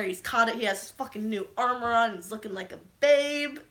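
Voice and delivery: normal talking voice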